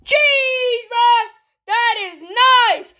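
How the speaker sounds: high-pitched, amazed exclamation; overjoyed, voice shooting up high; thrilled outburst. Real, not acted. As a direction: cry out at an incredible pull. Reacts to big card pull.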